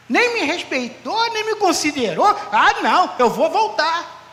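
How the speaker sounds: loud, high, hammed-up indignation; mock touchy believer. Touchy believer offended by the pastor, mock loud indignation. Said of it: "Se eu fosse um crente melindroso".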